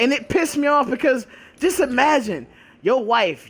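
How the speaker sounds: ordinary talk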